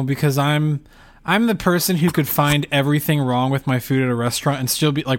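ordinary delivery

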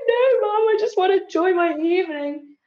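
high, whining plea to mum; whiny protest. Retold or imitated. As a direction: complain like a teenager to her mum.

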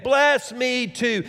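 high, drawn-out pleading yell; impassioned acted cry for blessing. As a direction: yell it slowly, word by word. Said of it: "he, he yelled".

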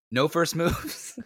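high, drawn-out retold panic; stuck in panic. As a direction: blurt it like a brain stuck on one sentence. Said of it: "immediately get stuck on".

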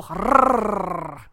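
long rolled growl; purr-growl it out, rolling; playful growl. Pretend. Playful growl after agreeing.

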